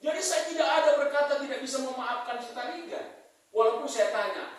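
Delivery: high, loud preaching voice; preaching at full force. Acted. Preacher urging the congregation to forgive, in a high, loud preaching voice. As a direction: drive the point home to the church.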